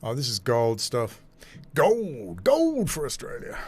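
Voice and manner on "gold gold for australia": swooping high cheer; mock triumph. Host delights in a great little article, mock triumph. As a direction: crow it like a gold-medal call.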